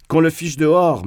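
plain reading voice

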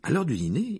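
ordinary delivery, nothing marked

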